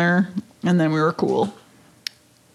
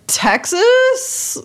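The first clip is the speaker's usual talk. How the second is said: unsure guess; 13 semitones higher than usual. Retold or imitated. Unsure answer to customs officer.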